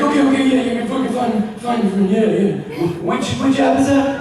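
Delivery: high, loud, flustered babble; hammed-up panicky voice; mock frantic. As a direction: play a panicking friend fumbling with a phone. Panicking friend acted on phone.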